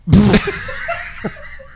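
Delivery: high-pitched giggling fit; laughing. Real, not acted. Cracking up after the answer.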